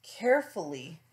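plain reading voice

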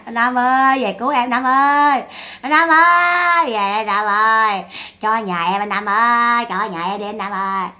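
long sustained calls, imitating a lament; mock pleading wail. Imitating Sáu begging the dead brother, mock wail. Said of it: "Sáu này chạy Sáu khóc quá khóc luôn".

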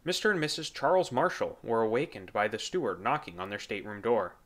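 ordinary delivery

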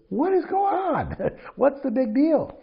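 high, slow mock-incredulous coach; high, slow imitation of a puzzled coach. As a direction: play it up as baffled disbelief. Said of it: "he goes".

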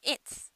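"It's" ending on a bare voiceless ts; no final U. Shown as a demonstration. Teacher modelling "It's" for learners, final U dropped. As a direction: say "It's" without a vowel after the s. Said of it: "Uを発音しないでください".

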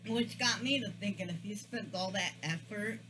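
talking with food in mouth; muffled through half-chewed bite; chewing while talking. Real, not acted. Seen 4: cheeks full, lips working around food while speaking; napkin in hand.

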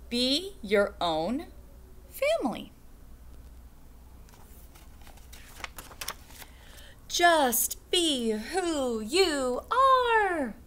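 slow, sing-song picture book reading; reading to children. Acted. Teacher reading a picture book to second graders, slow sing-song. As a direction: stretch each line out for the kids.

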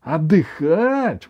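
high, slow outraged echo; grandma outraged at being told to rest; indignant. A narrator's reading. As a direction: throw the word back in disbelief. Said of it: "возмутилась бабушка".

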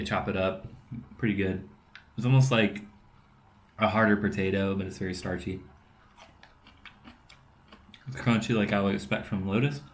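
talking between bites; while chewing food. Real, not acted. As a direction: mouth partly full. Seen 2: Holds lotus slice by mouth, takes bite at 4.6, keeps talking.

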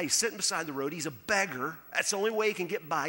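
normal talking voice

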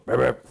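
low croaking ribbit; a frog croaks mid-reading. Pretend. Preacher croaking like a frog interrupting reading. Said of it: "That was a frog that just croaked".

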